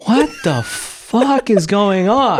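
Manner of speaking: slow, high, baffled disbelief; stunned slow what-the-hell. Retold or imitated. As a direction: drag it out like you can't believe your ears. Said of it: "And I'm just like".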